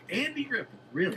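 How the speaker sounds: high-pitched, baffled retort; incredulous question. Retold or imitated. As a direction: act out sheer disbelief. Retold disbelief at superfan.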